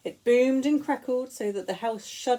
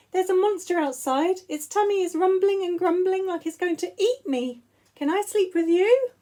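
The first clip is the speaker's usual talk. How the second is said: high, louder little cub voice; a frightened cub begging to come into the bed; small child pleading with fear; scared Baby Bear; 8 semitones higher, 5 dB louder than usual. Acted.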